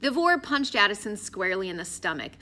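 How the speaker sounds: plain reading voice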